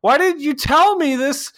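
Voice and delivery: high, loud, mock-outraged complaint; exasperated rant. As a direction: squeak out your disbelief at the wasted day. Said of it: "I'm like".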